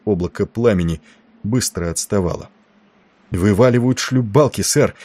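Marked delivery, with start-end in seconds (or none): 3.3-4.9 raised, eager lookout's voice; excited report. A narrator's reading. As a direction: report a sighting with excitement, pitch up. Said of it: "возбуждённо доносил копковый мальчик". (возбуждённый доклад)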